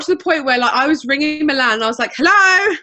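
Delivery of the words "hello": calling down the phone. Retold or imitated. Ringing the office in Milan.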